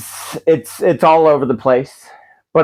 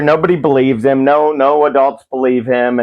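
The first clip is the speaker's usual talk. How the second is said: ordinary talk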